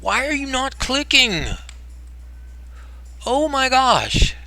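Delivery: high, drawn-out cry of frustration; high-pitched, fed-up complaining; exasperated whine. Real, not acted. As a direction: whine in exasperation at gear that won't work. Exasperated at a mouse not clicking.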